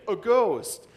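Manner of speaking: read-aloud cry of fright, high-pitched but contained; mild terrified scream. A narrator's reading. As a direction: give a small mock scream of terror. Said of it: "they were terrified and screamed".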